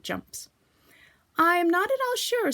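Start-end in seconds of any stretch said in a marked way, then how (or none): 1.4-2.5 higher, louder, musing Kanga voice; thoughtful. A narrator's reading. Kanga teasing Piglet, thoughtful voice. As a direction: wonder aloud, playing along. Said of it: "said Kanga in a thoughtful voice".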